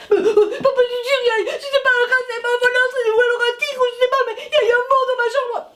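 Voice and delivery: put-on high, loud, breathless child's babble; panicked rush. The girl blurting to her dad about the monster, high panicked babble. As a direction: tumble the words out in fright. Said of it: "tu parles beaucoup trop vite".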